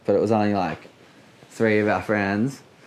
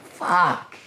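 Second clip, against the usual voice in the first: choked curse; 7 semitones higher than usual. Retold or imitated. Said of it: "fully choking".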